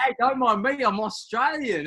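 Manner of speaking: loud, slow hammed-up Australian accent; jokey Australian accent. Drunk in the silent disco queue, jokey Australian accent. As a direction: put on a jokey Aussie accent to blend in. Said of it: "one little sort of jokey accent".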